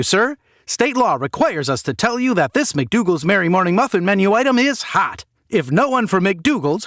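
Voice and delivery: fast-food cashier greeting a customer, forced cheer; chirpy cashier. Acted. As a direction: sell the muffin menu like a trained robot. Cashier reciting legal script.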